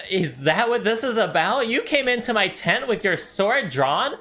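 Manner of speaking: high indignant outrage; scandalised, voice climbing; incensed halfling. Acted. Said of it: "He's kind of incensed".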